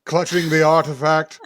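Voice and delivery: dramatic summoning sorceress; grand spooky narration. Acted. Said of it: "I am Magpie".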